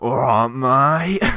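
a playful Aussie "mate"; cheeky Australian accent. Retold or imitated. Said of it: "a proper Australian".

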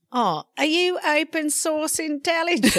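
high-pitched mock British accent; British accent impression. Co-host teasingly quoting the podcast guest, British accent impression. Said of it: "Is that your British accent impression".

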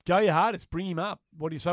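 ordinary talk

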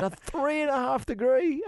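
a very high, rising "A three and a half degree?" in mock shock; stunned disbelief. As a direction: squeak out the disbelief with the pitch jumping up. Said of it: "I said".

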